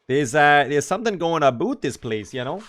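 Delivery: put-on Canadian character accent; exaggerated Canadian vowels and lilt; hammed-up attempt at a Canadian accent. Shown as a demonstration. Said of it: "all I know about the Canadian, uh, like, accent".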